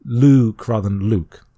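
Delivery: Luke with a long drawn oo, then Luke clipped short. Shown as a demonstration. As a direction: stretch the oo in the first Luke, keep the second short. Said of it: "a longer U sound is best".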